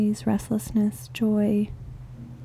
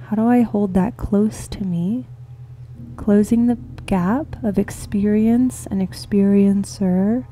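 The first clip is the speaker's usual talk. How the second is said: guided meditation; 7 semitones lower than usual. Real, not acted. Meditation leader inviting listeners into the heart space, calm and unhurried. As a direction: ease them gently into this moment, leaving space between phrases.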